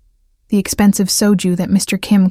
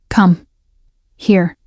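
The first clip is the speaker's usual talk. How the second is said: slower, each word set apart. Acted. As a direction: pause between Come and Here. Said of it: "slower this time".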